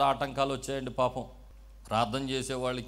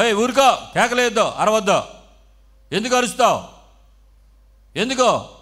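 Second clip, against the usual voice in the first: acted crowd scolding, high and very loud; hectoring, raised-voice demands for silence; loud rebuke; 7 semitones higher, 12 dB louder than usual. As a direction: shout him down in short bursts. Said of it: "గద్దిస్తున్నారంట అతన్ని".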